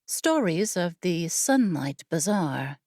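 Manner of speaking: ordinary delivery, nothing marked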